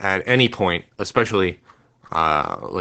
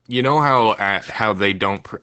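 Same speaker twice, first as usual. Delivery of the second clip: normal talking voice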